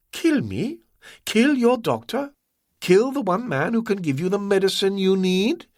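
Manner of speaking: lightly voiced scornful villain; smooth villain voice. A narrator's reading. Ah Wong voiced by narrator.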